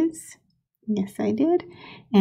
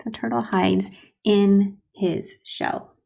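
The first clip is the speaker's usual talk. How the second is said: slow dictation; much slower than usual. Shown as a demonstration. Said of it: "slow dictation while writing".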